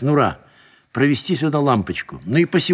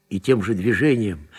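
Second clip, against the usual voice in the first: ordinary delivery, nothing marked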